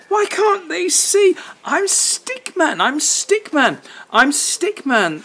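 high, slow plaintive stick figure; Stickman pleading. Acted. Stickman begging the dog to see him, high plaintive. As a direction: plead to be understood.

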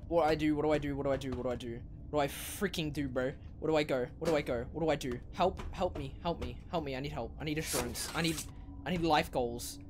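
low, hushed, repetitive fretting; panicked muttering. Real, not acted. Player lost and needing help, muttering anxiously. Lost, repeating pleas under breath.

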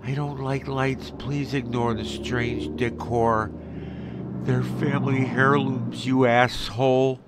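loud, emphatic mock delivery of a rude message; reading out a message. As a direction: project the message loudly, word by word. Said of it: "That's the message I got".

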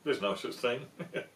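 cheeky bedtime narration; storybook narration. A narrator's reading. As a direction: relish the mouse's trick. Reading the book aloud.

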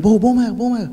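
re-enacted shouting, pitched high; retold shout. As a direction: call them over urgently. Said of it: "ואני צועק להם".

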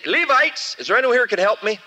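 high, loud, fast call for help. Acted. Preacher calls the Levites to take the goat, loud fast call. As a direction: call across the court for the Levites.

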